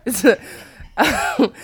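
gasping between laughs; hysterical laugh. Real, not acted. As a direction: still giggling helplessly. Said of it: "Why are you laughing so hard?".

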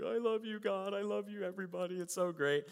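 high-pitched, overcome worshipper imitation; mock emotional gushing. Everybody having an emotional experience, mock gushing. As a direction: gush with hammed-up emotion. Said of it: "has this experience emotionally".

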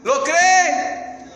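loud, high, pressing question; challenging question. Real, not acted. Preacher presses the congregation whether they believe, loud. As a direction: press them hard on it.

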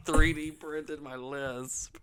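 laughing while repeating it. Real, not acted. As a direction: giggle through the words.

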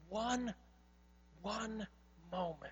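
One ... One ... moment, each word alone and hushed; dramatic pauses. Real, not acted. Pastor to congregation, weighing One One moment with slow pauses. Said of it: "spacing out the key words".

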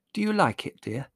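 high-voiced tender question; kindly mother. A narrator's reading. Mother asking about the birthday present, kindly. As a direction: ask hopefully, in a motherly voice.

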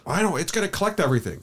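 high, quick mock protest; mimicked wife's objection. Husband retelling his wife's objection to an end table, mock high protest.

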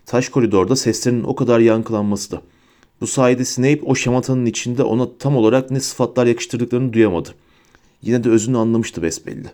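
ordinary delivery, nothing marked

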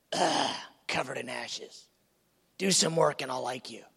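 scorn her ashes like a cruel stepmother; disdainful stepmother voice; wicked stepmother. Acted. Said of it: "like the wicked stepmother".

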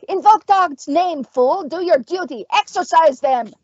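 high, forceful witch's order; angry command. A narrator's reading. Witch rounding on the stumbling priest, angrily commanding. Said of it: "She rounded on Egbert".

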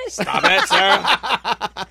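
loud, laughing through the words; laughing protest. Real, not acted. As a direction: beg her to stop through laughter.

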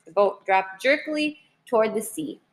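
usual speaking voice

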